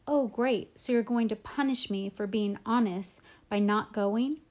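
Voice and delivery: usual speaking voice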